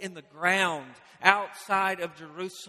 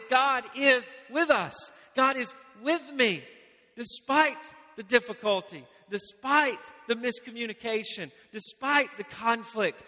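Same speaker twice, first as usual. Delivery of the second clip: higher, louder fervent sermon lines; preaching at full force; 4 semitones higher, 3 dB louder than usual. Acted. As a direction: proclaim it, building conviction.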